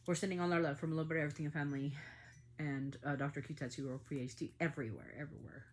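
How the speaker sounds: normal talking voice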